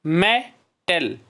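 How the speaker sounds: "Metal" slow, split as मे, टल; syllable by syllable. Shown as a demonstration. Teacher showing where the sound breaks, syllable by syllable. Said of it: "जहाँ से sound break होती है".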